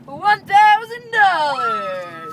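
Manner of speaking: slow, loud prize announcement; announcing big news. Acted. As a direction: drag it out like a jackpot. Host awards money.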